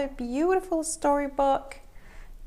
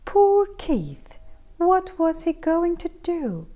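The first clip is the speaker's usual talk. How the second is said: ordinary delivery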